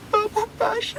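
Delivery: tiny, very high mock-crying voice; acted timid whimper; tearful squeak. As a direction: squeak it out through pretend tears. Crying boy repeats the fatal words.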